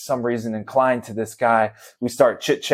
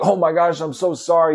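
higher-pitched imitated apology; apologetic; 6 semitones higher than usual. Leaf-blower guy to startled dad, apologetic. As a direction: retell a stranger's flustered apology, pitched up. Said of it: "he's, like, so apologetic".

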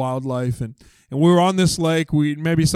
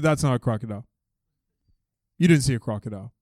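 deadpan contradiction; 6 semitones lower, 4 dB quieter, faster than usual. Retold or imitated. Boy coolly challenging the counselor.

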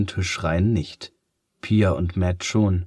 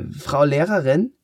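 put-on high, cracking boy's cry; voice cracking with excitement; 8 semitones higher than usual. A narrator's reading. Boy calls the teacher, voice cracking with excitement. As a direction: voice breaks over in alarm. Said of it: "Matts Stimme überschlägt sich vor Aufregung".

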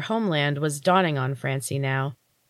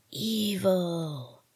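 softer, hissing creature voice; hissed; 2 semitones higher, 6 dB quieter than usual. Acted. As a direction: spit the word out as a menacing hiss. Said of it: "they hissed".

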